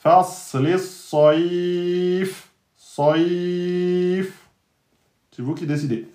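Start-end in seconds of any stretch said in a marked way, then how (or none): 0.0-4.5 "saif" held four beats, very slow; four-beat vowel. Shown as a demonstration. Teacher shows a four-beat stop. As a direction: hold "saif" long. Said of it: "je dis quatre temps".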